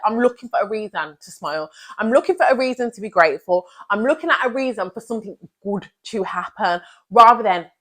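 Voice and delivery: ordinary delivery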